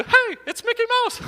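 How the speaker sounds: very high squeaky mouse voice; a high cheerful cartoon mouse; high squeaky Mickey impression. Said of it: "characters I did".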